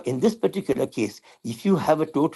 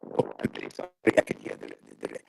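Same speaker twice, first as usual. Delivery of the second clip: crackling bad phone line; breaking up over static; loose cable crackle; 4 semitones lower, 7 dB quieter than usual. Real, not acted. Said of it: "there's crackling in your audio".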